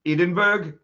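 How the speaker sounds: Edinburgh in a clumsy Icelandic accent; a bad stab at an Icelandic accent on Edinburgh; attempted Icelandic accent. Shown as a demonstration. Jay fakes an Icelandic accent reading Edinburgh. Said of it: "I was trying to say it like I was from".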